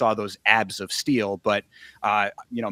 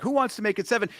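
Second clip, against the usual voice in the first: higher, pitched-up fight announcer call; a fight announcer over the intercom, hyping the crowd, pitched up; hammed-up intercom announcer; 10 semitones higher than usual. Hyping the crowd like an intercom announcer, pitched up. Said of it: "I love this announcer over the, the intercom 'cause he goes".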